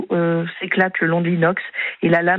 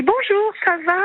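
much higher, breezy singsong hello; fake cheery greeting; 12 semitones higher than usual. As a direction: play it falsely innocent, as if nothing happened. Abusive husband arrives casually.

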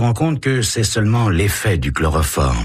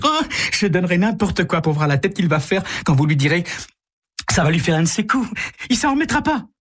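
much higher, giggly delight; gleeful girl; 12 semitones higher, faster than usual. Acted. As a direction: gloat with childish glee. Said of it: "Carole a un petit rire".

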